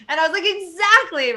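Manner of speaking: high, loud laughing burst; laughing hard after the mic drop line; real laugh. Real, not acted. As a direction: laugh through the words. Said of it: "Mic drop".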